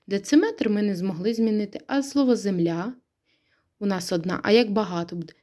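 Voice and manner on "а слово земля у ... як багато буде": ordinary delivery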